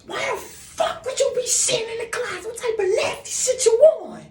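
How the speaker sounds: high, furious mom screech; angry mother. Retold or imitated. Mother scolding daughter for the closet, high furious yell. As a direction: scream like a disgusted mother. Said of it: "this is how I'm picturing her".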